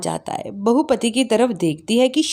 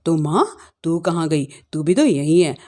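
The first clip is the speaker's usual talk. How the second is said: much lower-pitched man's voice; husband character; 10 semitones lower than usual. Acted. Husband answering his mother back, low-voiced. Husband's lines in acted family story.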